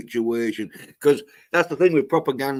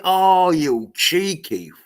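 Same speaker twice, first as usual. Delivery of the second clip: slow, drawn-out sneering insult; higher, slower mock telling-off; mocking scold; 6 semitones higher, 4 dB louder, slower than usual. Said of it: "Yeah, that's like".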